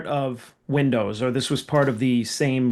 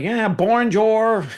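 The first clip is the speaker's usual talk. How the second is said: high, drawn-out Arkansas French; mock an Arkansan saying bonjour; Arkansas accent; 8 semitones higher, 4 dB louder, much slower than usual. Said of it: "like, Arkansas saying bonjour".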